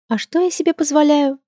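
high, sweet, innocent question; sweetly smiling. Acted. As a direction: ask with a sweet little smile. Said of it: "с милой улыбочкой уточнила я".

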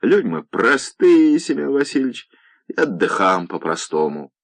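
playful mockery. A narrator's reading. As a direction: tease them playfully, savouring it. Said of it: "смотрел на гостей игриво". (игривая насмешка)

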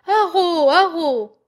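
loud, high cry raising the alarm. A narrator's reading. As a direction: call out for help at the top of the voice. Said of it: "s'écria-t-elle". (cri d'alarme fort et aigu)

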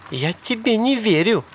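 high woman's voice; high-pitched disbelief; distrustful protest. A narrator's reading. Sally rejects his promise.

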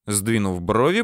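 ordinary delivery

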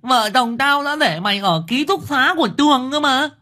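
very high, shrill wailing woman's voice; frantic mother wailing at her son; shrieking mother. A narrator's reading. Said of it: "Liên đã lại rú lên".

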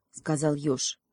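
ordinary delivery, nothing marked

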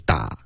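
打 as a wide "dah" for the weak "a"; mispronunciation demo. Shown as a demonstration. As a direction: show 打 with the mouth too open. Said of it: "别发成打". (示范错读：a 发成"打")

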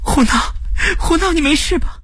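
high, sobbing voice full of worry; very high-pitched, crying concern; tearful. Acted. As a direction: call out anxiously on the verge of tears. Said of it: "熟悉的声音里带着哭腔".

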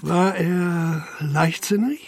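slow, hushed question; a careful whispered probe; discreet whisper. Acted. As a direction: lean in and whisper it slowly. Inspector probing discreetly.

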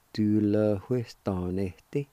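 Tu lo ves tan este, slowly, with a faint breathy h before the wi in ves. Shown as a demonstration. Teacher pointing out a faint H sound to students, slow and careful. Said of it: "There's a faint H sound right before the W I".

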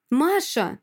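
high, urgent shout of the name; calling out. Acted. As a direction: call sharply to stop her. Boyfriend calls out to restrain her.

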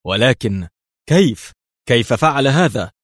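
loud, high, astonished questioning; stunned exclamation. A narrator's reading. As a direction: exclaim in disbelief, voice climbing. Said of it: "هتف مساعده ذاهلا".

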